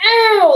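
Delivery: a hurt little ow; pained ow. Shown as a demonstration. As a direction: say ow like your heart hurts. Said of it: "he's saying".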